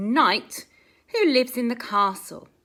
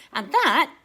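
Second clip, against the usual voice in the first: ordinary delivery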